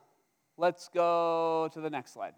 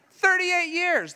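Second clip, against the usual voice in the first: much higher, slow and loud; outraged exclaim; 11 semitones higher, 6 dB louder, slower than usual. Real, not acted. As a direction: hammer home the outrage. Preacher repeating in outrage.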